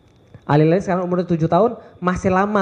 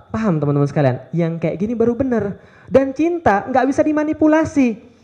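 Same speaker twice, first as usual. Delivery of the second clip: usual speaking voice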